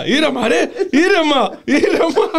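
high-pitched, laughing pleas to calm down; mock dog-video calming. Host parodies a viral dog video, high mock pleading. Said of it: "Σαν το βίντεο στο Λούμπεν με τα σκυλιά".

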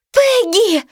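high calling shout. Acted. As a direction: call the dog's name from afar. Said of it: "закричал вдруг знакомый голос".

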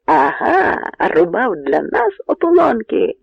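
loud, high-swooping taunt; jeering shout. A narrator's reading. Poles see Bohun crash through the ice, jeering shouts. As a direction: shout it gloatingly at the fallen enemy. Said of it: "закричали поляки".

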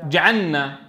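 جعلنا with the lam merged into the nun; rushed merged lam. Shown as a demonstration. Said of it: "بتعجله وعدم ضبطه فيقول".

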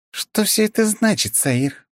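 high, alarmed questioning; shocked question. A narrator's reading. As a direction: demand an answer, alarmed. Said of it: "спросила Фуори".

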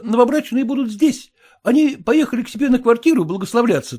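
high, fast, eager host; excited best man. A narrator's reading. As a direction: rush to invite the general.

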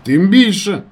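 high, loud retort; shouted reply. A narrator's reading. Narrator answering his doubting friend, shouting. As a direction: call it back down the line.

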